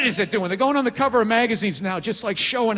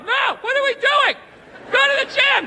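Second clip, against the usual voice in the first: much higher, whiny inner voice pleading; panicked inner voice; 12 semitones higher than usual. Retold or imitated. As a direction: squeal like your conscience begging. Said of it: "my in-shape voice is in the back going".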